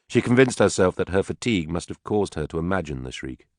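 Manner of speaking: usual speaking voice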